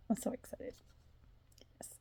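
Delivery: breathy, sighing whisper of delight; sighed whisper. Real, not acted. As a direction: sigh the words out dreamily. Sighs it out whispered.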